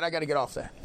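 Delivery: ordinary talk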